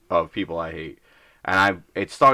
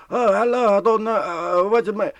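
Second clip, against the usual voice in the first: high, louder imitated old-man voice; old-world European man; 11 semitones higher, 4 dB louder than usual. Confused old customer greeting the clerk, high mock old European voice. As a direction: play a tiny old European Muppet of a man. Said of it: "old world European that it looks fake".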